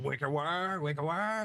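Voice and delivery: high, honking repeat of the name; ear-destroying silly voice. Retold or imitated. Host imitating their own antics that hurt listeners' ears, high grating voice. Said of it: "Probably just destroyed all the ears".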